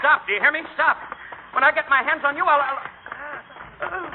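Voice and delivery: shouting after them. Acted. Charlie yells at fleeing Joe and Mabel, furious shout. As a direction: yell after the runaways.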